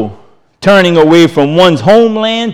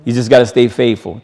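normal talking voice

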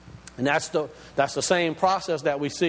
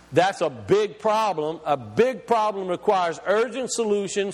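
ordinary delivery

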